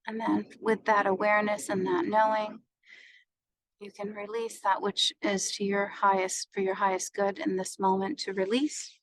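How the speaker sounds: even meditation voice with long pauses; guided meditation. Acted. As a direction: guide them through each breath, unhurried.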